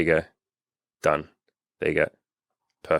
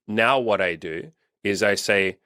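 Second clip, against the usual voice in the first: ordinary talk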